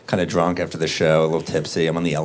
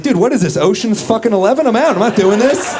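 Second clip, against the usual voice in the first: high, loud panicked protest; hammed-up terrified squeal; 14 semitones higher, 6 dB louder than usual. Panicked escape.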